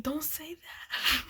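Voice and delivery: slow, high whisper of warning; scandalised whisper. Retold or imitated. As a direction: hush them, scandalised. Coworkers scold the remark.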